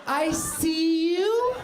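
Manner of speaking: high, slow, exaggerated sympathetic voice; hammed-up overly earnest apology, drawn out; woke accent. Comic mocking diversity-trained whites, high, slow, syrupy. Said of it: "woke accent".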